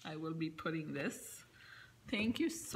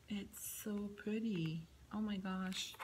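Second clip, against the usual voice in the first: hushed whisper; 3 dB quieter than usual. Real, not acted. Admiring a gift, hushed.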